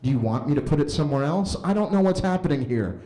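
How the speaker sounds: ordinary talk, nothing marked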